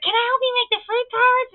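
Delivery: put-on very high, loud child voice; squeaky keen kid impression; eager little kid. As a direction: imitate a small boy begging to join in. Voicing eager boy offering again.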